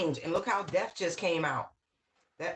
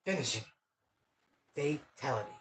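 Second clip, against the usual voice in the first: low, soft fighting-game announcer voice; 4 semitones lower, 5 dB quieter, much faster than usual. Retold or imitated. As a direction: drop low like a game's finishing call. Quotes video game announcer.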